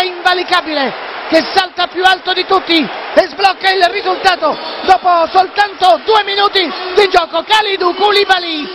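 high, fast excited play-by-play; excited commentary. Real, not acted. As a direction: keep the thrill going. Excited goal recap.

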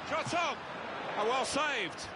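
high-pitched burst of commentary; excited shot call. Acted. Commentator reacting to a save, excited.

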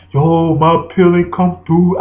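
slow, drawn-out complaining inmate voice; whiny inmate. Retold or imitated. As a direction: whine it like a broke inmate. Voicing a fellow inmate.

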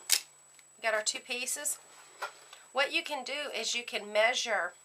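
usual speaking voice

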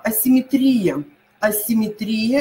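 асимметрия stressed on «три»; асимметрия, stressed second to last. Shown as a demonstration. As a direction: hit the И before the final Я in асимметрия. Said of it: "правильный, э, вариант".

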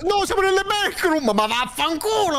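high, panicked protest; shrill frightened swearing; being chased. Real, not acted. Stuck in the backrooms, chased.

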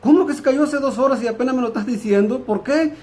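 high-pitched, incredulous questioning; indignant disbelief. Acted. Wife hears late news of her mother's fall, high indignant disbelief. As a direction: demand an explanation, voice pitched right up.

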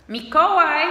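high, loud call of a mother; mother calling out sharply to her son; loud high shout of a name; alarmed shout. Acted. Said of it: "krzyknęła mama".

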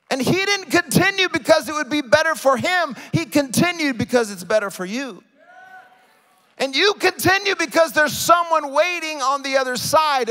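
high, loud, driving exhortation; impassioned preaching. Real, not acted. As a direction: drive it home to the congregation at full force.

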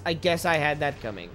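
normal talking voice

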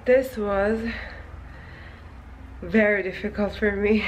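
panting after a workout; breathless, gulping for air; out of breath. Real, not acted. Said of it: "I'm out of breath".

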